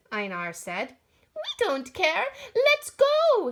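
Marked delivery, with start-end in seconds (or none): 1.4-3.5 much higher, loud excited shout. Acted. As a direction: cheer it out eagerly. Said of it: "the three shouted".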